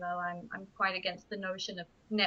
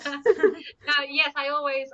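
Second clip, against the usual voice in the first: laughing; 7 semitones higher, 5 dB louder, much slower than usual. Real, not acted. Laughing after joking reply.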